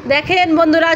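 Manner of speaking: ordinary talk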